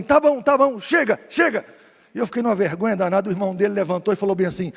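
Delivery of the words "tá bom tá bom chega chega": a retold drunk man yelling, higher and louder; mock drunk shout. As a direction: bellow it to cut the preacher off. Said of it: "o cara gritou assim".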